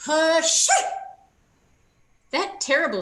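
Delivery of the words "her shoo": loud sneezing burst; hammed-up sneeze. A narrator's reading. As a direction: act out a big sneeze. Sneeze in story.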